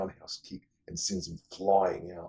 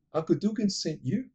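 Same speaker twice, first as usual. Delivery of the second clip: higher, lightly voiced young woman asking; shop girl voice; 9 semitones higher than usual. Vlogger retelling the film, girl questions Van Damme, higher voice. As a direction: pitch it up as the girl.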